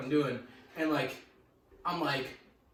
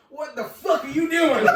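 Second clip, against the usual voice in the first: high, loud mock outrage; 7 semitones higher, 5 dB louder than usual. Classmate seeing sparks from the outlet, imitated outraged yell. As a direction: act out a classmate yelling in alarm. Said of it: "this hick next to me, he goes".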